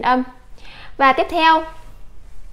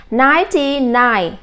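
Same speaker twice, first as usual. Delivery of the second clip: slow model reading. Shown as a demonstration. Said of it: "and và chín chín nhé".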